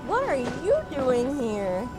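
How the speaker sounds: ordinary talk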